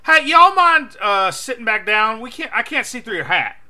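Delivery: loud, high exaggerated Southern twang; twangy Southern. Loud twangy Southern ask to the guy in front. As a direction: twang it up like a country fan. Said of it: "so I twang it up".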